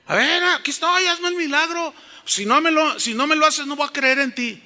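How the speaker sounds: high-pitched mock ultimatum; defiant challenge. Mimicking someone who demands a miracle from God, high and defiant. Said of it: "y desafían a Dios".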